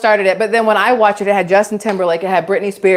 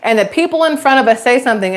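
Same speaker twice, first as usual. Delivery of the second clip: usual speaking voice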